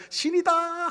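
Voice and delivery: the chief's awestruck cry; awed chief. Acted. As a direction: proclaim him a god in wonder. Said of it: "추장이 이렇게 말했어요".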